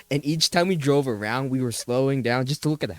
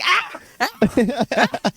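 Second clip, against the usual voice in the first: panicked screams; 12 semitones higher, 3 dB louder than usual. Retold or imitated. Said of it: "I'm like".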